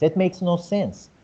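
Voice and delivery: usual speaking voice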